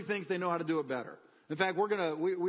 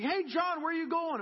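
lightly voiced neighbor calling, higher; 9 semitones higher than usual. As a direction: call out a cheery hey to John. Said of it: "one of my neighbors said to me".

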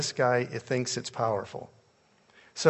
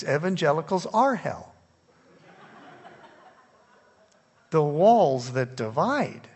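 higher, louder preacher's push; fiery preaching; 7 semitones higher, 5 dB louder than usual. Acted. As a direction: preach it hard to the congregation. Fiery preaching about Catholics and evangelicals.

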